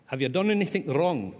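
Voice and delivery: put-on high, loud, lousy Scottish accent; hammed-up Scottish questioning; attempted Scottish accent. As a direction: have a bad go at Scottish. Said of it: "retell the story without trying the accent".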